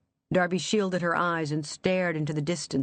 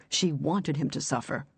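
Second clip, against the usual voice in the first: plain reading voice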